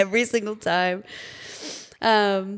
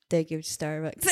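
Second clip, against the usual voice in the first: no emotion; 6 semitones lower, 7 dB quieter than usual. Shown as a demonstration. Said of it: "have no emotion and take your order".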